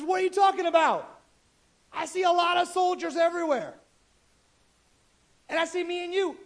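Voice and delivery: high-pitched mock alarm; lightly panicked servant, high voice; incredulous servant. Retold servant panics at surrounding army.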